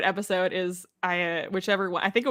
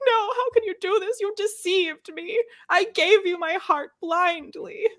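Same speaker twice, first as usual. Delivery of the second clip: mock Galadriel wail; 11 semitones higher than usual. Friend mocking Galadriel pleading with her captor, high hammed-up wail. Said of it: "Galadriel will be like".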